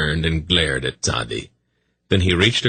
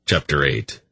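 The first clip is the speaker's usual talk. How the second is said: plain reading voice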